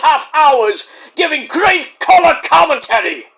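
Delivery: booming, high, drawn-out wrestler impression; Bad News Wade Barrett. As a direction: proclaim it loudly in mock-British grandeur. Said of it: "who I do a great impression of".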